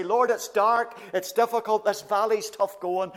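loud, pleading cry of panic; panicked plea. Retold or imitated. Believer panicking in a dark valley, loud pleading cry. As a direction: cry out to God in mock panic. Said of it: "tempted to panic and say".

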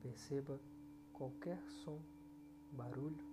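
soft, hushed meditation voice; soft spa whisper. Acted. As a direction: lull the listener gently. Leads a guided meditation.

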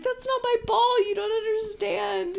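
high, loud whiny protest; squealing kid; panicked kid. Retold or imitated. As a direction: plead in panic.

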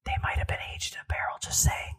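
hushed, hurried whisper; whispered aside. Real, not acted. Host slipping listeners a beer tip, whispered aside. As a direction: whisper it like a sly insider tip. Sly aside about barrel-aged beers.

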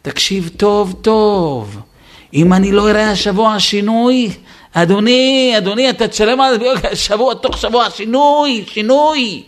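loud, high, threatening parent laying down an ultimatum; stern ultimatum. Acted. Father threatening his son with an ultimatum, loud and stern. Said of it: "אולטימטום לילד".

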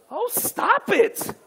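high, drawn-out "oh, stop it"; exaggerated "stop it". Shown as a demonstration. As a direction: stretch it out slowly and playfully high. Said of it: "Now say it like this".

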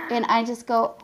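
usual speaking voice

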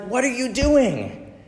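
high-pitched, drawn-out exasperated outburst; frustrated mom. Acted. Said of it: "She's frustrated".

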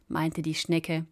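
ordinary delivery

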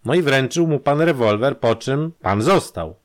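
the inspector pins the suspect with each word; with emphasis. A narrator's reading. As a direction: drive the accusation home. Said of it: "dokończył z naciskiem Grąż".

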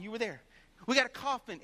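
normal talking voice

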